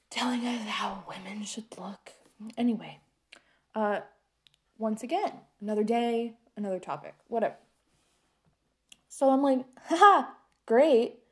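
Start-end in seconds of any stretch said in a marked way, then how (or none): 0.1-2.1 whispered bitter aside; mocking whisper to the audience; sarcastic whisper. Sarcastic aside about the patriarchy. 9.9-11.2 higher, louder mock-cheerful "haha"; forced chipper laugh; sarcastic fake laugh. Sarcastic reply to an insult.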